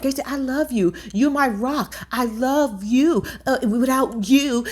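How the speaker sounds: high, slow mock loving voice; a hint of gushing sweetness, imitating her; lightly voiced clingy friend; mock sweet plea. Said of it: "she was like".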